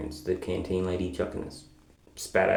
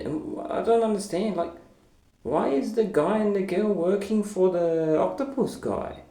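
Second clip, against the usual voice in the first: confused; 10 semitones higher than usual. Real, not acted. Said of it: "So I'm very confused".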